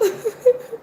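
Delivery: high-pitched little laugh; light, high laughter. Real, not acted. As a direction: let out a high giggle. Laughs after asking.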